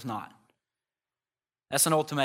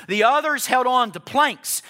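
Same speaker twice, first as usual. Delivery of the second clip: full-force preaching; 11 semitones higher, 11 dB louder, slower than usual. Acted.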